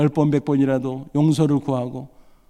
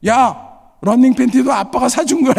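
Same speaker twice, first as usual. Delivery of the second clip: higher and louder mock shout; petty yell; 9 semitones higher, 7 dB louder than usual. Father shouting at his son walking out, petty shout. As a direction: yell it after him, childishly spiteful.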